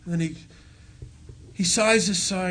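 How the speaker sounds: usual speaking voice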